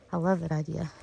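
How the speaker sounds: ordinary talk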